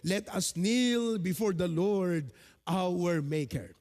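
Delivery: slow, solemn reading of the verse; measured Bible reading voice; scripture reading. Acted. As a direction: read it out like holy words, unhurried. Rereads the verse.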